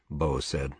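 plain reading voice

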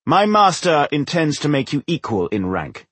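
high, projected announcement; straining to be heard. A narrator's reading. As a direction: call it out over a noisy crowd. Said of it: "straining to make himself heard above the snarling".

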